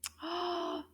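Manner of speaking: soft indrawn gasp of delight. Pretend. Teacher discovers chocolate in the bag, delighted gasp. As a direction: gasp at a lovely smell. Smelling what was in the bag.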